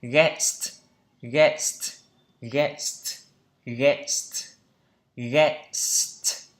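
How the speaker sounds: "Guest" with a drawn-out s before the t; teacher drilling the st ending of "Guest"; audible S. Shown as a demonstration. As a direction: model "Guest" word by word with a clear st. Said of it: "có S các bạn nhé".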